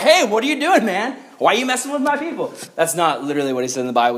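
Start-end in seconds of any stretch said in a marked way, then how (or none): 0.0-2.5 higher, mildly indignant confrontation; casual God. Acted. God confronting Saul on the ground, higher-pitched and indignant. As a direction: call him out in a raised pitch. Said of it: "God shows up and he's like".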